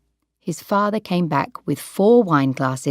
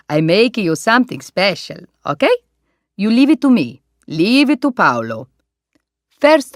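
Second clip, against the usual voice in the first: put-on jolly Italian owner with a broad Italian accent; Italian restaurant owner; 2 semitones higher, 5 dB louder than usual. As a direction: beam at the birthday guest, Italian style.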